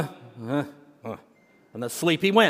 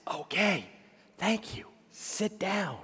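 quiet; 12 dB quieter than usual. Retold or imitated. Preacher quietly dismissing congregation.

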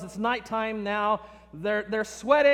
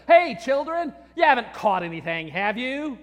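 condescending; 2 semitones higher, 4 dB louder than usual. Acted. Stranger on shore calling condescendingly to fishermen, loud and mocking. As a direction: talk down to them like kids. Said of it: "He's condescending to them".

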